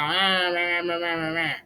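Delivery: mocking whiny meh. Mocking the commentator's complaint.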